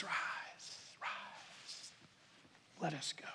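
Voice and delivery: hushed, whispered rise, rise; whispered call. Acted. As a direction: breathe it like a secret. Jesus's call to the sleeping disciples.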